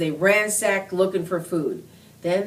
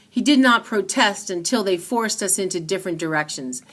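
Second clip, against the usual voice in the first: ordinary delivery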